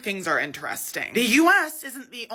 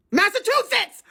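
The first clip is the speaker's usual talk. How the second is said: much higher, shouted word; frustrated yell; 12 semitones higher, 4 dB louder than usual. Real, not acted. Frustrated retry.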